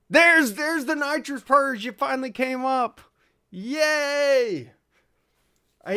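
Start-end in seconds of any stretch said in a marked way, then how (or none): 0.1-4.7 high, slow and delighted; excited cheer. Real, not acted. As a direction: cheer as the alert finally shows up. Nitrous purge finally pops up.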